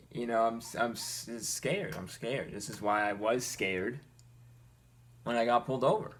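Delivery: normal talking voice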